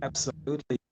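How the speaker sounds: ordinary talk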